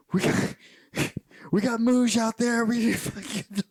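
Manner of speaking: high, loud mock yelling; imitated panicked yell. Colonel running up the stairs to warn of Mooj, mock yelling. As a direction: yell it like a cartoonish alarm. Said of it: "he's yelling about Mooj".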